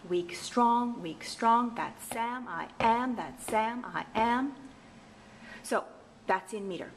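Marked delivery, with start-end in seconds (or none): 0.1-4.5 steady alternating beat, "weak STRONG", "that SAM I AM"; weak-strong pattern. Shown as a demonstration. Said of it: "the pattern looks like that".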